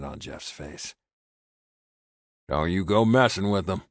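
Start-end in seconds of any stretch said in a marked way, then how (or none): 2.5-3.8 gruff ranch hand warning. A narrator's reading. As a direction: growl the warning a little. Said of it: "he finished in a gruff voice".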